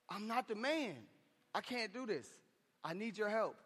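hushed prayer for help; humbled plea. Acted. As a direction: admit it quietly to God, pitch lifted. Prayer of surrender to God.

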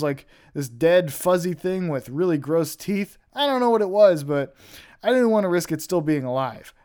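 ordinary talk

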